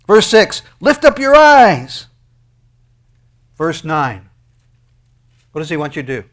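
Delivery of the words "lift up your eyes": slow shouted command. Acted. Preacher shouting God's command.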